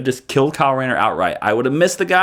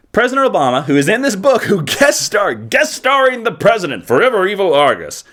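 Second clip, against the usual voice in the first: mock announcement; 6 semitones higher than usual. Mock cover announcement of guest star.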